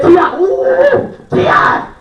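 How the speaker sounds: high, slow swooning call; starstruck squeal. Retold or imitated. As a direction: swoon over the star's name. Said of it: "But if I would've said".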